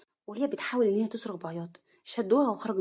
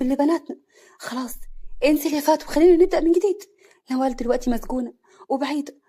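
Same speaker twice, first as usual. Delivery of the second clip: higher, loud begging; pained; 4 semitones higher, 7 dB louder than usual. Acted. Husband begging his wife to stay, pained. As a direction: plead for a new start. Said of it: "حسن بألم".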